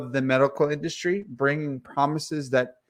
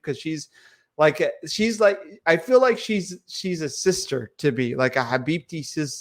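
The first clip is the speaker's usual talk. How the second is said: usual speaking voice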